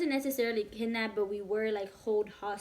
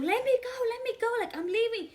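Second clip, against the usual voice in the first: retold scream; 11 semitones higher than usual. Girl telling the guys to let her leave, retold scream. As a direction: imitate your own panicked-sounding plea, pitched way up. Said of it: "So I started screaming".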